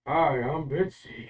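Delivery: slow, loud, booming magic voice. Acted. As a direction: boom it out slowly across the woods. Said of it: "like, this loud booming voice".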